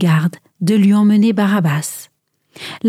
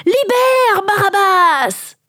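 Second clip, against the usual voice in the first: high, drawn-out shout from the crowd; crying out; 10 semitones higher, slower than usual. A narrator's reading. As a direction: shout it high, stretching the syllables. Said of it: "une voix s'écria". (cri aigu et prolongé)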